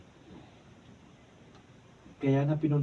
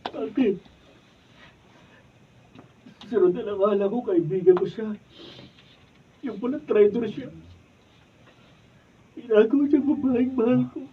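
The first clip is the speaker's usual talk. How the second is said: louder, higher anguished cry; betrayed outburst; 8 semitones higher, 7 dB louder than usual. Acted. Daryl to Maya after learning of the betrayal, anguished. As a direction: let the betrayal build to a cry.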